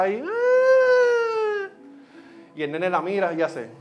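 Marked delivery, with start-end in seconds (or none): 0.2-1.7 put-on a sudden high, loud child's wail; wailing toddler cry. Retelling a TikTok of a crying girl, high loud wail. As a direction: let out a little girl's bawling cry. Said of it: "la nena está llorando".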